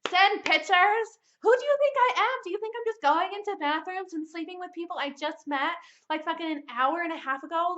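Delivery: high, loud outraged questions; indignant, rising shout of disbelief. Retold or imitated. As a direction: fire it back at him like an insult. Outraged at the friend's text.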